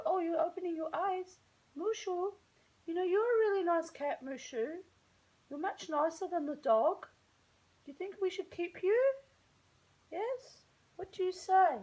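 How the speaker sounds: high cooing pet talk; talking to the cat in a high pet voice. Real, not acted. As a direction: coo at the cat sweetly.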